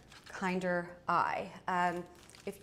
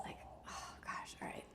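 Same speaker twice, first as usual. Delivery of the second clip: whispering; 13 dB quieter than usual. Real, not acted. Aside, whispered.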